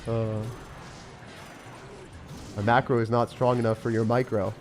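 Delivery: ordinary talk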